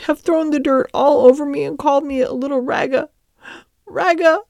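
put-on high tearful child, breaking off mid-word; acted child sobbing. A narrator's reading. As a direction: stammer through mock sobs in a high voice. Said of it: "she sobbed".

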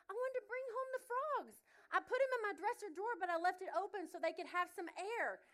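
put-on high, innocent child explaining herself; little girl voice. Daughter explains to mom about the frogs, innocent little girl voice. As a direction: play the kid explaining sweetly.

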